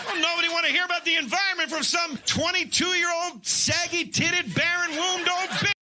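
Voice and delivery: comic rant. Acted. Comic ranting insult.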